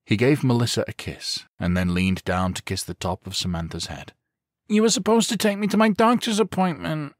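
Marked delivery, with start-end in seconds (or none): 4.7-7.1 put-on high, sulky child's voice; pouting little girl. A narrator's reading. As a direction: complain like a let-down little girl. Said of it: "she pouted".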